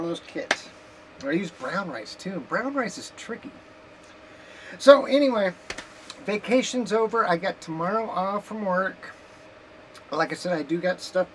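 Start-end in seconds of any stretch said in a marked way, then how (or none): none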